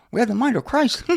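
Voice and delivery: mousy, high-pitched imitation; James Corum. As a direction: put on a small, high mousy voice. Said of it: "A little mousy".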